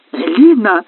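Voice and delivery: high, loud yelp of her name; squealing. A narrator's reading. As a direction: squeal it in outrage. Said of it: "взвизгнул полотенец".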